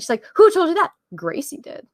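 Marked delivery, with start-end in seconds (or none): 0.4-0.9 high, loud imitation of disbelief; retold incredulous outburst; mock startled demand. Acting out her startled demand. 1.2-1.9 low, soft, unruffled imitation; deadpan understated reply; matter-of-fact Spock. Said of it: "he's so matter of fact about it".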